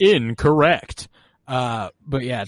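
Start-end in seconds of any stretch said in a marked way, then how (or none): 0.0-1.1 mock verdict. Slow, loud mock ruling.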